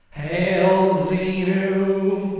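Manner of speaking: slow loud sung alien chant; drawn-out sung alien hail. Pretend. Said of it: "put on our alien voice".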